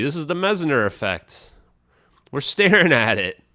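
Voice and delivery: earnest, pushy online science-guru delivery; conspiracy science host. Acted. To viewers, hyping suppressed superconductor news, fervent conspiratorial host. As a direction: sell the cover-up theory to your viewers like a zealous streamer.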